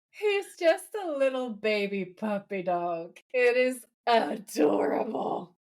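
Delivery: slow, drawn-out cooing over a puppy; gushing adoration. Real, not acted. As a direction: gush slowly, stretching each word. Gushing over a cute character.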